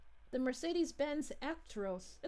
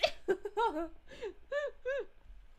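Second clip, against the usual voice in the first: high, helpless laugh that almost turns to tears; crying laughter; 8 semitones higher than usual. Real, not acted. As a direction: laugh until it nearly breaks into a cry. Laughs at the pun.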